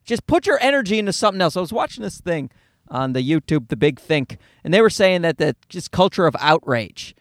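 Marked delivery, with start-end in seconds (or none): none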